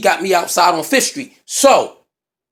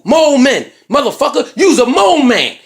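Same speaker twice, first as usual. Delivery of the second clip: mocking taunt; 5 semitones higher, 4 dB louder than usual. Mocking him in street argument.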